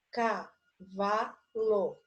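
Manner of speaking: "Cavalo" very slowly, in three separate syllables; sílaba por sílaba. Shown as a demonstration. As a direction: break "Cavalo" up as ca, va, lo. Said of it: "trissílaba". ("Cavalo" bem devagar, separando as sílabas)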